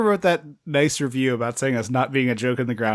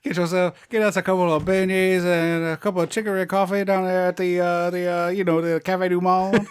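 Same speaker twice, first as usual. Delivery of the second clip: mock New Orleans accent; 6 semitones higher than usual. Heroine suggesting beignets and coffee, mock New Orleans accent. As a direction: ham up a Southern belle's accent.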